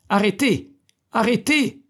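high, loud repeated shout; drawn-out cries. A narrator's reading. Sentry's cries echoing under the town gate, high shout. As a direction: call down the street to stop him. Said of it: "les cris".

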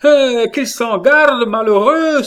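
high, loud alarmed exclamation; carried-away cry. A narrator's reading. Catherine warning her reckless son, impassioned cry. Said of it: "s'écria Catherine, emportée".

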